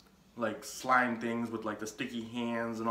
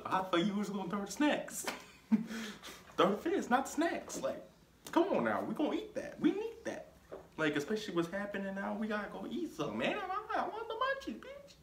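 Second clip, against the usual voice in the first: squeaky, high comic outrage over snacks; mock outrage; 11 semitones higher than usual. Narrator joking about the ruined snacks, squeaky mock outrage. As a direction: ham up the grief for the snacks.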